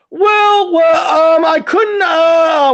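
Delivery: high, loud, panicky mock stammering; exaggerated whiny floundering; flustered stammer. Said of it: "when they do the, uh, uh".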